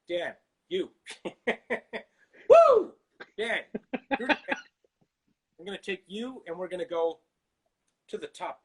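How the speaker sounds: keyed-up film-star pep talk with whoops; lightly voiced intense motivator, breaking off between phrases; rally them to the top; Tom Cruise. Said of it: "Tom Cruise?".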